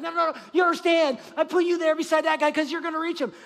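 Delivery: loud, emphatic no no no as God; God insisting. Acted. Pastor voicing God, insisting to the reluctant believer, in a loud shout. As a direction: shout it like God overruling you. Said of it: "And God's like".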